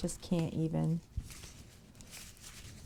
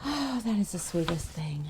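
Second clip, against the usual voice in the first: whispered. Real, not acted. Streamer moved by a viewer's note, whispering. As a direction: breathe it out, too moved for voice.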